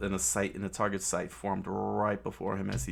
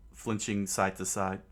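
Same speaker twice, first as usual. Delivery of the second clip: ordinary delivery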